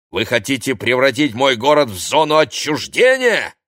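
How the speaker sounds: loud, high tirade; angry ranting. A narrator's reading. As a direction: rant and threaten as an irate mayor. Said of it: "рявкнул мэр".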